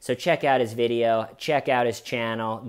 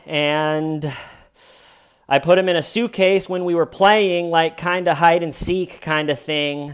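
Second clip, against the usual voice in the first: higher, louder mock-innocent retelling of her 911 story; imitating Sarah; 5 semitones higher, 7 dB louder than usual. Retelling her 911 call to the firefighter in a louder, higher mock-innocent voice. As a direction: play her chirpy bluff about the suitcase game. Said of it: "Sarah Boone says, quote".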